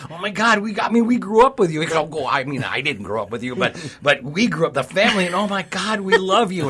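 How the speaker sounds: high, loud starstruck gushing; a hero turned fan, tripping over words. Retold or imitated. Hero gushing over Melissa Gilbert.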